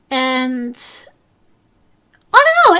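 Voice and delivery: normal talking voice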